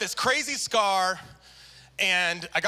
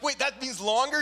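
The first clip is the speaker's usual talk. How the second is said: high, alarmed question; a sick man's dismayed squeak; dismayed surprise; 7 semitones higher than usual. Retold or imitated. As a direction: realize the wait just got longer. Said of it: "And I'm like".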